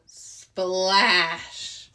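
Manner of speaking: loud splash sound effect. Acted. Storyteller voicing Arnold hitting the water, loud splash. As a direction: land the word like a splash.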